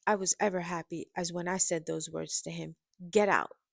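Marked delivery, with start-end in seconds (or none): 3.1-3.6 loud, falling command; forceful order. A narrator's reading. Woman throwing her ex out, forceful order. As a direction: order them out with finality.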